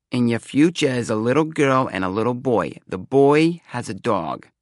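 prophesy with solemn theatrical weight; fortune-teller. A narrator's reading. Seer foretells two children to young man, portentous.

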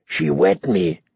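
low croaked protest; low, strained croak. A narrator's reading. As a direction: croak it out, dropped in pitch. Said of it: "he croaked".

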